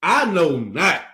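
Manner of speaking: slow, measured Bible reading; scripture reading. A narrator's reading. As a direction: read the verse out slowly, with weight. Reads the scripture aloud.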